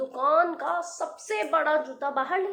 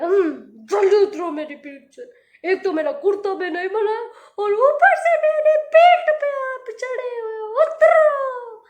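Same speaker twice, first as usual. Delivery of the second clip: much higher, much louder wailing command; crying giant; 7 semitones higher, 9 dB louder than usual. A narrator's reading. Giant sobbing at the tailor to climb down. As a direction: bawl at him to get off.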